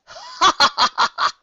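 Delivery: high cackling villain laugh; evil mocking cackle. A narrator's reading. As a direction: laugh at your sister's pride. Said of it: "The second Alicorn laughed".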